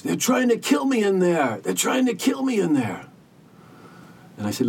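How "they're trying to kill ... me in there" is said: retold panicked scream. Jake strapped in the ambulance, screaming in terror. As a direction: shriek it like you're being dragged off. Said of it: "screaming at the top of his lungs".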